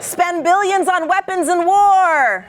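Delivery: rally the crowd with each prompt; shouted prompts. Shown as a demonstration. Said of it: "calling out prompts to audience".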